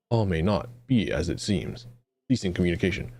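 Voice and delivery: low, fast robotic status readout; flat clipped robot report; machine voice. Acted. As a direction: rattle it off like a failing computer.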